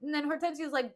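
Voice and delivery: ordinary talk, nothing marked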